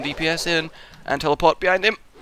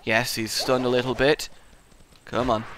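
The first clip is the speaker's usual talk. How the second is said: usual speaking voice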